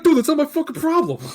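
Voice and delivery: loud, fast, high mock protest; hammed-up, rushed comeback; imitated defensive demon. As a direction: play the demon brushing off blame, loudly. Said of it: "He's like".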